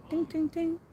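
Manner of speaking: high sing-song phone chime; imitated phone dings. Phone dinging again just before the fall, high imitated dings. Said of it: "the phone was dinging again".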